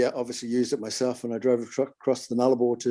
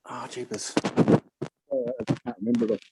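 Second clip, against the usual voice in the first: muffled. Real, not acted. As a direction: hand over mouth. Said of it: "Sorry, you just got muffled there".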